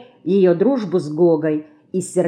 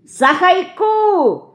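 put-on very high, drawn-out toddler cry; little child's slow, piping yell; Марьяшка; 13 semitones higher, 4 dB louder, much slower than usual. A narrator's reading. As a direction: call out in babyish pronunciation. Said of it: "кричит Марьяшка".

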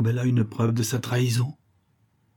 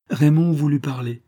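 usual speaking voice

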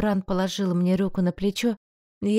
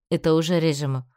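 plain reading voice

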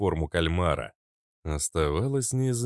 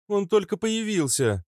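female character voice; 13 semitones higher than usual. Acted.

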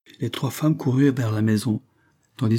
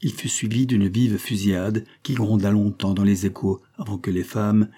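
plain reading voice

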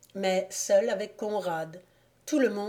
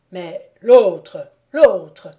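loud, urgent questioning; agitated insistence; 13 dB louder, much slower than usual. A narrator's reading. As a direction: burst out, haunted by what was seen. Shaken count asks about the apparition.

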